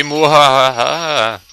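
hammed-up villain cackle, slow and loud; a badly faked evil mwahaha; terrible evil laugh. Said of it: "That was a terrible evil laugh".

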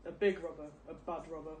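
ordinary delivery, nothing marked

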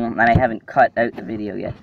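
normal talking voice

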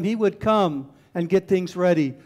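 normal talking voice

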